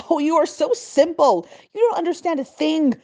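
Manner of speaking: high, loud condescending scoff; rude governor. A narrator's reading. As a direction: belittle her with contempt. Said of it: "replied the governor with a rude wave".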